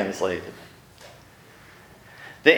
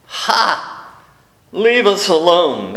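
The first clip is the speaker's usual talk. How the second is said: demon crying out; 5 semitones higher, slower than usual. Retold or imitated. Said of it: "cries out in a loud voice".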